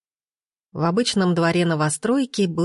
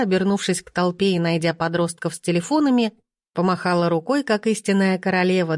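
ordinary delivery

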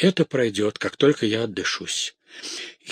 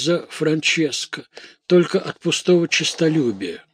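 high, panicked, gasping out exclamations; acted hysterical fright, pitched well up; frightened maidservant; 6 semitones higher, 3 dB louder than usual. A narrator's reading. Maid begging to be held before she faints, panicked. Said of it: "Я так испугана".

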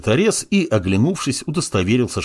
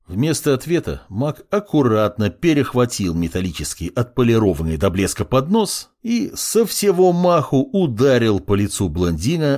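plain reading voice

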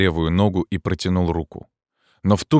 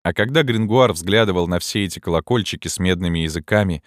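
ordinary delivery, nothing marked

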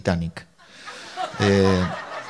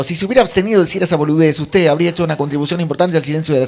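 high, loud, rapid-starting mock rebuke; indignant rebuke; 5 semitones higher, 7 dB louder, faster than usual. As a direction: deliver a pompous putdown as a heckler. Espectador replica con sorna. (reprimenda indignada imitada, aguda y fuerte)

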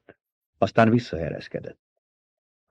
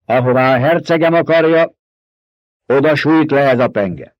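loud, higher, commanding ducal voice; Paul proclaiming; 5 semitones higher, 5 dB louder than usual. A narrator's reading. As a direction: declare it like a lord before his people. Paul leads the oath. (ünnepélyes, parancsoló hercegi hang)

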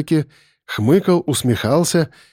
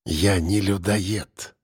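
low, soft, slow and cold; cold denial; 5 semitones lower, 6 dB quieter, much slower than usual. A narrator's reading. Captor denies being a cannibal, coldly. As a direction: deny it slowly, with icy calm. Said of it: "Он холодно улыбнулся".

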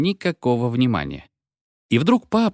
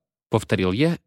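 ordinary delivery, nothing marked

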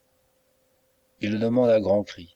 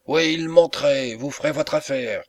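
narrated shout; 6 semitones higher than usual. A narrator's reading. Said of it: "crie encore Bark".

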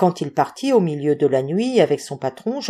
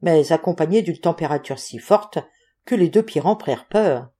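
ordinary delivery

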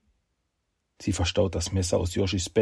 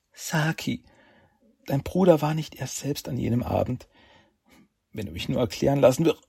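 desperate pleading; 7 semitones higher than usual. Acted. Heroine pleads, then choked.

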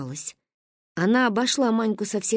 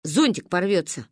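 low, even statement; matter-of-fact verdict; 3 semitones lower than usual. Acted. Verdict explained, echoed by offended child. (спокойно, как приговор)

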